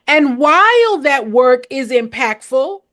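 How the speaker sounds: ordinary talk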